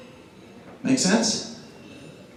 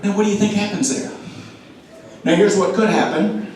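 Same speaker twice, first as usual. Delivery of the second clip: usual speaking voice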